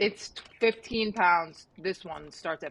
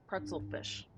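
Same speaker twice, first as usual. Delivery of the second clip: usual speaking voice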